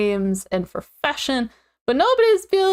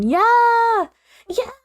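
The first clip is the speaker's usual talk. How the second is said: excited; 10 semitones higher, 4 dB louder than usual. Real, not acted. Excited agreement with co-host.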